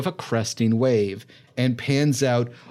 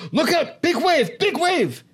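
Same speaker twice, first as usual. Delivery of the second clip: high-pitched screamed warning; screaming captain. Acted. Captain screams into the tinny speaker system as the wave looms, urgent scream. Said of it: "as he screams into it".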